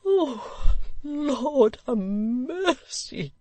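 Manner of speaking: high, very slow whimper. Acted. Old gentleman whimpering at the Lord Mayor. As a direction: wail it out helplessly. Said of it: "whimpered the old gentleman".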